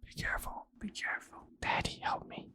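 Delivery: mock-scared whisper; whispering a pretend plea to daddy; child begging daddy, whispered.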